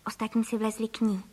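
usual speaking voice

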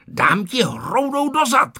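high, drawn-out threat; narrated boy's high angry cry; angry yelling. A narrator's reading. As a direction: yell a slow, cross threat. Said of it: "křičel Ferda".

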